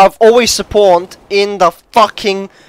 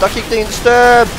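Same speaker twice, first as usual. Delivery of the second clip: higher-pitched complaint, rising on DISTURBED; exasperated raised voice; escalating annoyance; 4 semitones higher than usual. Real, not acted.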